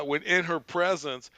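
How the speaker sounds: ordinary delivery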